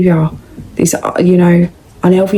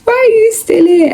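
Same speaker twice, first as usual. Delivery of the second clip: much higher squealing disbelief; squeaky disbelief. Retold or imitated. Mocking an ex who came back, high squeal. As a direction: squeal it up high in mock horror.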